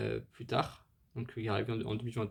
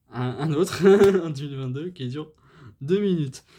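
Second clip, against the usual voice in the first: louder, higher, breaking into laughter mid-sentence; real laughter over the words; laughing through it; 3 semitones higher, 9 dB louder than usual. Real, not acted. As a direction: give up on the name and laugh it off. Laughing at his own mispronunciations.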